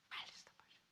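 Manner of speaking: breathy whispered echo, hushed; whispered repeat. Shown as a demonstration. Pastor whispers the warning to the congregation, hushed.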